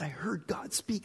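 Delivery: near-whispered, breath-heavy report; hushed revelation. Retold or imitated. Friend tells retreat leader he heard God, hushed. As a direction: drop to a whisper, as if sharing something sacred.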